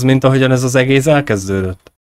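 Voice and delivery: ordinary talk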